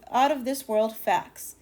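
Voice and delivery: ordinary delivery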